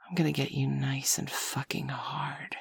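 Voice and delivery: soft, voiceless whisper close to the ear; teasing whisper. Acted. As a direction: whisper the promise right into his ear. Intimate threat, whispered.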